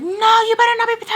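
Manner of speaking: very high mock yell; high-pitched, hammed-up scolding; retold yelling. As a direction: imitate yourself yelling a warning. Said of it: "yelling at her".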